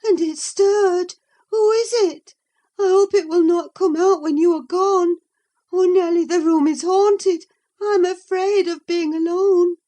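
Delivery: high and loud, rising panic; terrified of the haunted room; frightened. A narrator's reading. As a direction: Catherine begging Nelly not to leave her alone, afraid. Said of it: "she pursued anxiously".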